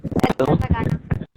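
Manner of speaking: breaking up over a bad line; choppy, cutting-out network voice; corrupted glitchy audio. Real, not acted. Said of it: "आवाज़ आपकी थोड़ी ma'am cut रही है". (टूटती कटती आवाज़)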